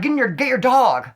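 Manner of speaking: high, loud panicked call; urgent plea. Retold or imitated. Pinned by a pit bull, begging the owners loudly and urgently. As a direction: yell for help with the dog pinning you. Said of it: "with a sense of urgency".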